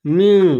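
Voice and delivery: মিউ held long through the nose; nasal ghunna. Shown as a demonstration. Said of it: "এখানে নুনটা গুণনা হবে, নাকে টেনে পড়তে হবে". (নাকে টেনে গুন্নাহ সহ)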